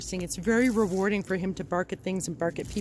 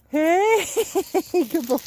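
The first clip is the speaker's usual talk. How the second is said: laughing praise; 8 semitones higher, 5 dB louder, slower than usual. Real, not acted. Trainer praising the dog on arrival, laughing loud. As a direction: praise him through a laugh.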